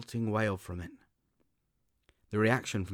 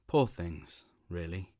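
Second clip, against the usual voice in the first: ordinary delivery, nothing marked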